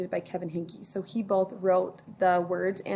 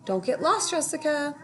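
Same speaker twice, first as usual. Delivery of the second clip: put-on higher, caring child voice; Ruthie's voice; 6 semitones higher than usual. A narrator's reading. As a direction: look out for your friend. Ruthie warning friend in tunnel.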